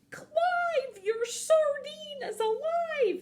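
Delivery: very high, loud cartoon cry; long-drawn high shout of alarm; hammed-up exclamation. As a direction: call it out high, stretching the words slowly. Story character exclaims over live sardine.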